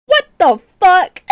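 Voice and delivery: high, loud, slow outburst of disbelief; mock incredulous. Streamer disputing a friend's self-criticism, loud mock incredulity. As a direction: draw it out in exaggerated outrage. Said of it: "And I'm like".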